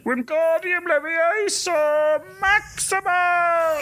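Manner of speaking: high, slow, drawn-out incantation; spell-casting professor. Acted. Professor at the crevice casts a spell, high and drawn out. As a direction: stretch each word high, casting a spell. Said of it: "you hear him saying".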